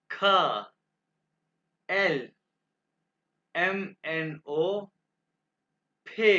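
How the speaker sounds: emphatic letter names from "गे" to "खू", well spaced; German alphabet demonstration. Shown as a demonstration. Teacher pronouncing the alphabet for students to repeat, emphatic model. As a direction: sound out each German letter on its own. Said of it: "मैं आपके सामने दो दफ़ा pronounce करूंगा".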